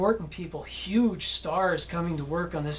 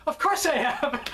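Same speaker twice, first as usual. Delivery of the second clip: higher-pitched mock-confident retort, on the edge of laughter; cocky assurance; 7 semitones higher than usual. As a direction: toss it off as an overconfident actor, amused. Co-star's breezy boast, retold laughing.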